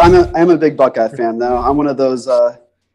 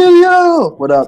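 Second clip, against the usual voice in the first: mock call-out; 4 dB louder than usual. Echoing the meme call again.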